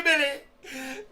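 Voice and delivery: ordinary delivery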